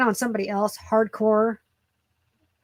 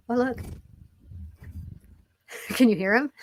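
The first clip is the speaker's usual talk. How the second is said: ordinary talk, nothing marked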